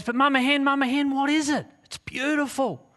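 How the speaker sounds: high, eager pleading with wonder; excited eaglet. Acted. Eaglet asking mama hen about the beautiful bird, excited. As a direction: pester mum excitedly in a high little voice.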